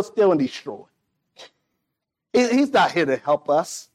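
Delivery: normal talking voice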